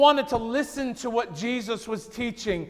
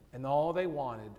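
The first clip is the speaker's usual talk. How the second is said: hushed whisper; 8 semitones lower, 11 dB quieter than usual. Real, not acted. Preacher whispering to the congregation about the townspeople's fear. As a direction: whisper it, almost unvoiced, the fear sinking in. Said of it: "preacher drops to whisper".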